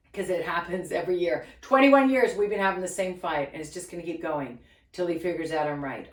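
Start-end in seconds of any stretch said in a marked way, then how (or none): none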